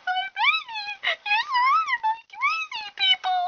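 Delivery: very high, loud tearful wail; hammed-up hysterical crying; hysterical mother. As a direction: shriek it through melodramatic tears. Mother horrified for her daughter.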